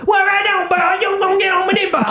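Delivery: very high garbled holler; hammed-up local character. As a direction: burst out in unintelligible cartoon local talk. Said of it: "Oh, you talk like that".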